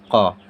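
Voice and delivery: क़ said short and clear; letter sound demo. Shown as a demonstration. Teacher modelling क़ for students, short and clear. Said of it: "क्या लग जाएगा".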